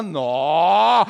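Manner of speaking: long "nó" swooping up from low; admiring Mineiro "nó". Acted. Mineiro watching a pretty woman pass, long admiring "nó". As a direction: stretch "nó" out in appreciation. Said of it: "Passou uma mulher bonita".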